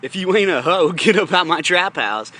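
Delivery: half-laughing tough-guy yell; mock yell. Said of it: "so I was like".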